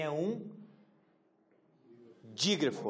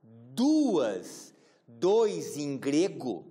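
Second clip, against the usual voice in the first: louder, slow teacher stress on "duas" and "dois"; slow emphasis; 6 dB louder, much slower than usual. Shown as a demonstration. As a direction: spell it out slowly for the class. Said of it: "ó, gente, ó". (ênfase lenta de professora)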